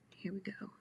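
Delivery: hushed whisper. Real, not acted. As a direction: murmur it under your breath. Quiet aside while drawing.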